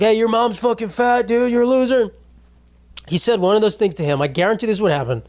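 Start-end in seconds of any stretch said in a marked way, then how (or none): 0.0-2.1 high-pitched jeering fan voice; mock heckler taunt. Fan in the crowd jeering a ballplayer, mock taunting. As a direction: imitate a loudmouth needling from the stands.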